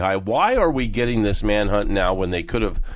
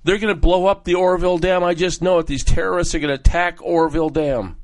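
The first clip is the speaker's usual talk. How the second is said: imitating her panic, higher and pressing; mock-frightened warning, pitched up; frantic staff member; 6 semitones higher than usual. Staff member insisting terrorists will hit the dam, frantic. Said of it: "came to me frantically".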